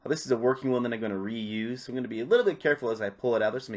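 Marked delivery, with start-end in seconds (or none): none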